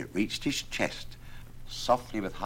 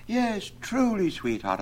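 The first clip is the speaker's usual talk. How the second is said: very high, slow eager yes; high eager lover. A narrator's reading. Absalom eagerly answers Alison, very high. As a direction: gush up high. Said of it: "answered Absalom".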